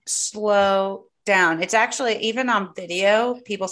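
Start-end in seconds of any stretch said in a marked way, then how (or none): 0.1-1.6 slow right down to show them; stretched, crawling delivery. Shown as a demonstration. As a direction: drag each word out very slowly. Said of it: "talking so slow".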